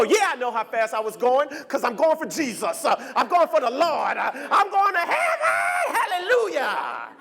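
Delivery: rising into a shouted preacher's praise; religious fervor. Retold or imitated. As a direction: build to a high, slow hallelujah. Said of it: "And you go".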